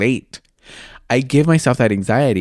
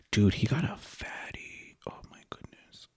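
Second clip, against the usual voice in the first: breathless awed whisper; 10 dB quieter than usual. Real, not acted. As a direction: whisper it like you can't believe him. Gushing over a man's photo.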